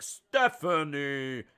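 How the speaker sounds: drawn-out, booming fighter introduction; ring announcer. Retold or imitated. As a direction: stretch each name out loud like a ring announcer. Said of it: "when they say their names, and they're like".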